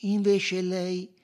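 high, loud and insistent; firm detective reply. A narrator's reading. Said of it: "replicò Poirot con fermezza".

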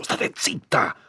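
high, forceful whispery order; sharp silencing. A narrator's reading. Priest cutting Agnese off, sharp and forceful. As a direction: shut her up urgently.

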